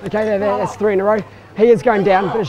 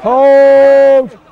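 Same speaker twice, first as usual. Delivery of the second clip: shouting calls; 2 semitones higher, 10 dB louder, much slower than usual. Real, not acted. Referee shouting calls in play.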